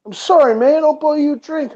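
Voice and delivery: high, loud blurted apology; retold apology. Puncher to the man he hit, retold emphatic apology. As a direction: apologise emphatically, pitched well up.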